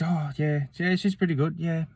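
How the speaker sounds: imitated girl's high-pitched yeah-yeah; retold eager reply. Voicing nervous girl answering Jim.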